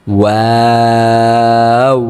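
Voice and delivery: ওয়াও drawn out slowly with fully rounded lips; rounded waw. Shown as a demonstration. Teacher showing students a round-lipped ওয়াও. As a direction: round the lips hard and chant ওয়াও long. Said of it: "ঠোঁট একেবারেই গোল করতে হবে".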